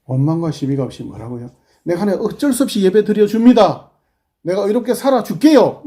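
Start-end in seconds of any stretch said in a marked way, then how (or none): 1.8-5.8 higher, louder mock grudging tone; grudging believer. Preacher mimics a grudging churchgoer, louder and higher. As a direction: play a believer doing God a favour. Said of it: "이게 아니고".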